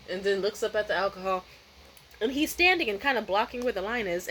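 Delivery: usual speaking voice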